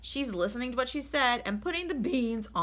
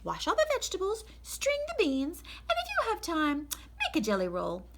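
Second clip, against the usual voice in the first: bossy lady of the house, much higher and brisk; Mrs Rogers; 8 semitones higher, 4 dB louder than usual. Acted. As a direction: read out the chore list as the fussy employer. Mrs Rogers gives chores.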